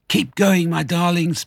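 high, strained urging; panting encouragement. A narrator's reading. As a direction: urge them on as if short of breath. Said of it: "panted Mister Fox".

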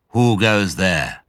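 slow, deep challenge from above. Acted. Badger's unseen voice challenging the foxes, slow and deep. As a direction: stop them with a slow challenge. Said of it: "a deep voice".

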